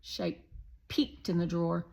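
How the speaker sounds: ordinary delivery, nothing marked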